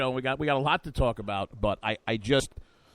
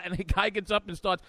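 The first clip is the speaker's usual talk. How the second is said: usual speaking voice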